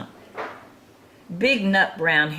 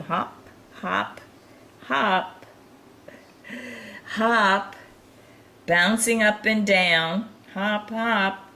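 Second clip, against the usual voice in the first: higher and bouncier with each hop; playful hopping; 6 semitones higher, slower than usual. Pretend. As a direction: bounce the words like a hopping bunny. Bouncing along with hopping picture.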